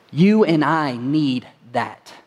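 usual speaking voice

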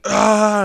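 high, loud drawn-out yell; minion battle cry. Acted. Minions reach behind themselves for weapons, high battle cry. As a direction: let out a high charging cry.

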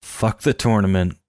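ordinary talk

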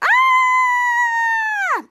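very long high scream; imitated scream. Charlotte and Jasper still sliding down, long high scream. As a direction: scream loudly, holding it as if falling.

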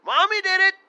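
loud repeated no, high and shouted. Retold or imitated. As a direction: shout it in disbelief, laughing. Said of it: "I'm like".

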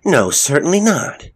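high, loud shocked denial; indignant, startled protest. A narrator's reading. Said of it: "shocked by Tommy's question".

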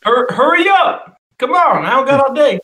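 high, pushy "hurry up"; impatient woman voice. Retold or imitated.

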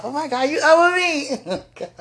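thrilled laughter at finding company; laughing delight. Real, not acted. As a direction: laugh through the words with joy. Delighted the viewer is awake.

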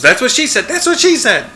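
high, loud mock scream, climbing on the repeat; Pam's scream. Host imitates a sitcom character screaming a joke, high scream. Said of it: "And Pam screams".

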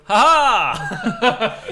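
loud, high burst of "Ha ha!"; big hammed-up ha ha; mock cheer "ha ha". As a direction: shout it out like a microwave ding cheer. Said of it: "and then we'll go".

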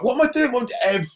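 high-pitched, startled disbelief; alarmed self-questioning. Retold or imitated. Passenger staring at his oxygen mask, high alarmed disbelief. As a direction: act out a sudden frantic realisation. Retold alarmed realisation during descent.

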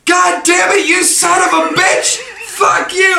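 hammed-up outraged manager shout; furious yell. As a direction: yell like a boss who just got pranked. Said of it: "he goes".

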